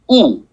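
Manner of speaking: "U" with lips pushed forward in a round oo; a clear rounded "U" demo; puckered u. Shown as a demonstration. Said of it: "pucker your lips and say".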